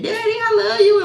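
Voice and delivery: put-on high, sweet little-girl voice to her daddy; a daughter gushing to her father, in a high sweet voice. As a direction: coo it up high like an adoring daughter. Girl voiced talking to her dad.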